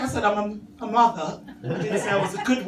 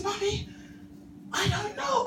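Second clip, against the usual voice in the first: put-on much higher, softer innocent child; little boy voice; 10 semitones higher, 7 dB quieter than usual. Son feigning ignorance to his mum, high and soft. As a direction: play dumb like a small kid.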